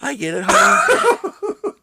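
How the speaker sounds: high, slow, sweet spouse voice; mock spouse. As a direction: coo it like a doting wife. Playing a soothing spouse.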